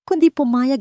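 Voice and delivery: plain reading voice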